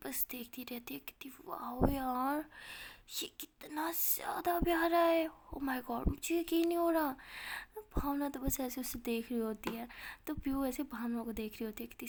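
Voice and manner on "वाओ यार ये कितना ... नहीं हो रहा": slow, drawn-out wonder rising higher; stretched-out wow, gushing in disbelief; awestruck amazement. Acted. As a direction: marvel at a stunning sight. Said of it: "भावना उसे देख के एकदम चौंक जाती है".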